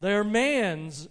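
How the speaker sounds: slow, loud pronouncement; solemn spirit. Acted. The Spirit answers Scrooge about the children, solemn pronouncement. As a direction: declare it slowly and weightily. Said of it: "said the Spirit".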